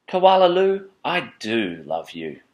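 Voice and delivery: fond mother. Acted. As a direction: sing out the name, then slow down fondly. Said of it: "imagined her saying again".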